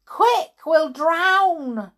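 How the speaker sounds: high, loud wail of alarm; urgent, panicked yell; desperate cry. A narrator's reading. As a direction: cry out in mortal danger. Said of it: "cried Tom".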